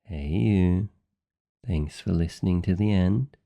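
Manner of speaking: ordinary talk, nothing marked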